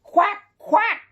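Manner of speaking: high, far squawking quack; duck quack. A narrator's reading. Narrator squawking as Duck at the darkening sky. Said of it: "squawked the duck".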